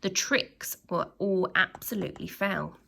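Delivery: ordinary delivery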